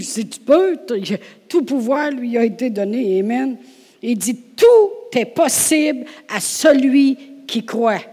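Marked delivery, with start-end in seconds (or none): 0.0-0.8 "Si tu peux" higher and louder, "peux" rising; indignant si tu peux. Shown as a demonstration. Jesus hurling the words back, higher and louder. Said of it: "il s'adresse à Jésus". 4.6-8.0 slow, higher, louder declaration; proclaiming. Acted. Jesus answering the father, slow and loud.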